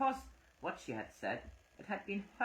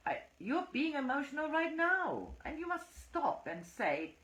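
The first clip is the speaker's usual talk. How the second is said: raised high, heated comeback; much higher heated woman's retort; 4 dB louder than usual. A narrator's reading. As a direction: fire it back, voice high. Phyllis retorting in quarrel.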